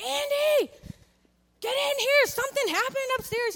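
high, loud call across the house to Andy; urgent call. Retold or imitated. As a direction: yell for him to come in now. Calling husband from the garage.

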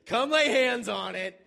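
very loud, high, slow insistent plea; imitating Justin pleading. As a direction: demand Josh come lay hands on it. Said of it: "He's like".

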